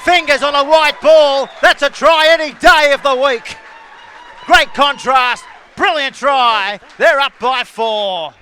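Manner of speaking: loud, high play-by-play shout; big punchy commentator's scoring call; excited try call. Real, not acted. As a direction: roar the try home to the crowd.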